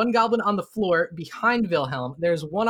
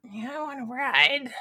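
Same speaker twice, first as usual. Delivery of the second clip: high, slow little goblin voice; eager goblins; 9 semitones higher, slower than usual. Acted. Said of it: "other goblins look at you and they're just like".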